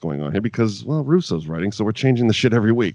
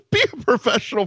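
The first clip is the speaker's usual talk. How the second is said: usual speaking voice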